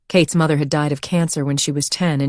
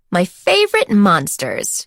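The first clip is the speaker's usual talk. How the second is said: fond cry; 2 semitones higher, slower than usual. Acted. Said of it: "she cried".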